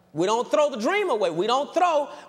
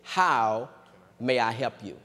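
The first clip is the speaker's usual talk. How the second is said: low, measured imitation of a courteous teller; lightly voiced customer-service politeness; polite bank teller; 9 semitones lower than usual. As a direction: offer help like a trained teller, pitched low. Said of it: "they say it like this".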